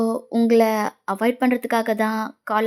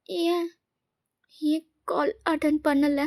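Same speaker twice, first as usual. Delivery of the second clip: soft, higher anxious self-talk; worried musing; 4 semitones higher, 6 dB quieter than usual. Acted. Girl alone wondering why he won't pick up, worried musing. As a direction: wonder to yourself, small and hushed.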